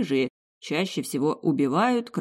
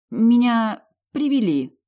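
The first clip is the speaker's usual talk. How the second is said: slow, strained, higher and louder; forced out; 6 semitones higher, 5 dB louder, slower than usual. Acted. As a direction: push the words out with effort. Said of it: "наконец выдавила я".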